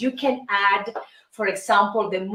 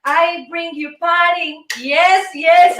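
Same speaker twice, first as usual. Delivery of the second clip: festive hype; 5 semitones higher, 3 dB louder than usual. Acted. Hyped show-opening greeting.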